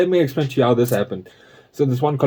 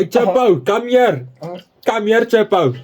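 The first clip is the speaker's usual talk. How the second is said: higher, half-hammed ancestor calling a servant; mock ancestor voice; 7 semitones higher, slower than usual. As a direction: lightly play a pompous old farm ancestor summoning Chepo.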